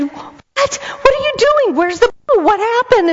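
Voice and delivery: high, startled alarmed questions. Retold or imitated. Grandma seeing the kids run in screaming, high alarmed questions.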